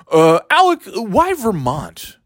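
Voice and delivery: high, slow whiny reporter; mock reporter. Comic mocking reporters asking Alec, high and whiny. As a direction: drag it out like a dim reporter.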